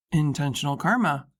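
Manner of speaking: low, dry male voice; male character voice. A narrator's reading. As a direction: drop it low as Drew. Drew's quip.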